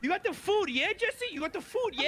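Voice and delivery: a silly high British voice; squeaky mock British.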